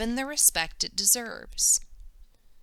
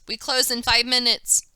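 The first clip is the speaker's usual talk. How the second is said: a loud, higher shopkeeper's shout; hollering; 5 semitones higher, 8 dB louder than usual. A narrator's reading. The cranky shopkeeper warns the girls, loud holler. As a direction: warn them off loudly, impatient. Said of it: "Mister Wong hollers".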